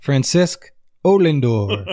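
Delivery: slow, laughing echo of a mock-Irish accent; funny Irish accent. Co-host repeating the favourite joke, light mock-Irish accent. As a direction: lightly copy the cartoon Irish brogue through laughter. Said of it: "that funny accent".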